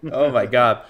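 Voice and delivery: normal talking voice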